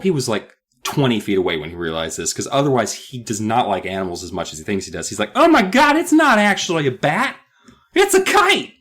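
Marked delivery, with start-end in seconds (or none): 5.3-8.7 shocked realization. Retold or imitated. Jerry realizes the bat is a kite.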